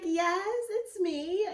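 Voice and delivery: high, eager reply; re-enacted high phone answer. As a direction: confirm it in a lifted, hopeful voice. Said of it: "I'm like".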